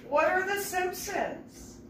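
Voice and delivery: high, slow, innocent question; baffled little girl. Retold or imitated. Young Susan asking Ian, high slow baffled voice. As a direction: ask it wide-eyed and slow.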